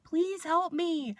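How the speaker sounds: high, drawn-out mock plea; retold yelling. Patient begging the nurse for help, retold yell. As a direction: stretch out an imitated cry for help. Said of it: "I was yelling".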